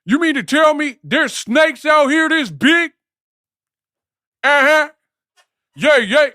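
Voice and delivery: very high shouted disbelief. Retold or imitated. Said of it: "He was Anaconda".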